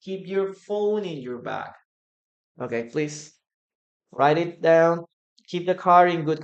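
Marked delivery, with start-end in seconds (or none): none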